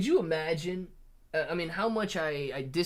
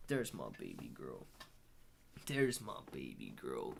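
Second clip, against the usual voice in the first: soft cooing; 13 dB quieter than usual. Real, not acted. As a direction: murmur fondly, deep and very soft. Cooing to baby girl mid-stream.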